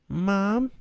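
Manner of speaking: a hint of a high little girl; small child. Stephanie calling to her mother in a small child's voice. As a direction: ask like a hopeful little girl.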